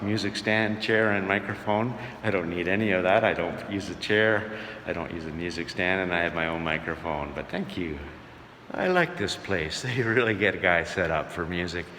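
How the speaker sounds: ordinary talk, nothing marked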